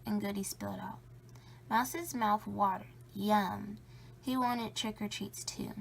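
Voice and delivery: usual speaking voice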